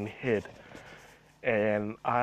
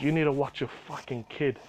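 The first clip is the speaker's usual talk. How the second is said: usual speaking voice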